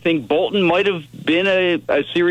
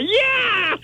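excited cheer. Retold or imitated. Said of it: "both jumped up and said".

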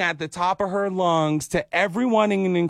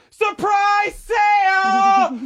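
high, slow, far-carrying yell; drawn-out shout; 4 dB louder, much slower than usual. Retold or imitated. Girl announcing a surprise sale to everyone, slow high shout. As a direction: call it out like a market crier kid.

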